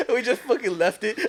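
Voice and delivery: words squeezed out through laughter, high; telling friends the gross part, laughing through it; laughing while talking. Real, not acted. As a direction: talk while cracking up.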